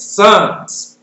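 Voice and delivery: Sons with a firm final s; stress on sons. Shown as a demonstration. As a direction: lean on the plural Sons. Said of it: "You don't say sons, say".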